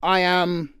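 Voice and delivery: ordinary delivery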